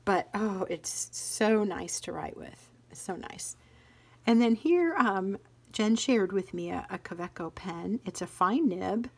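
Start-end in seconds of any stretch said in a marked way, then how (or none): 2.9-3.6 soft breathless whisper of delight; hushed, admiring whisper; whispered aside. Real, not acted. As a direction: whisper it to yourself, savoring. Savoring the pen, whispered aside.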